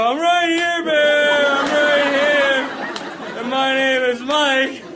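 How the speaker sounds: mock the silly instruction; mocking sing-song. Speaker mocking guide's bear advice, sing-song.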